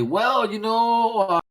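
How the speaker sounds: put-on high, slow folksy old man; old seller tempted by cash, high voiced; old timer haggling. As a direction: hem and haw, high and drawn out.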